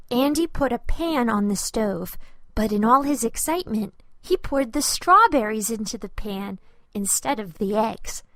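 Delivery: usual speaking voice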